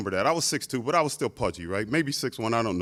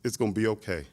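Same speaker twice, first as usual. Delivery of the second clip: soft, lower comfort; reassuring; 6 semitones lower, 7 dB quieter than usual. Retold or imitated. Coach comforting crying thirteen-year-old on the mat, soft and reassuring. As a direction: gently reassure a crying boy. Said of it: "and he says".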